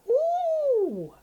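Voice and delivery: long, high "ooh"; imitated high, drawn-out whoop; high hype whoop. Reacting to the DJ's banger, high whoop.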